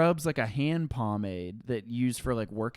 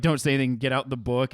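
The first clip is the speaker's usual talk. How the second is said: normal talking voice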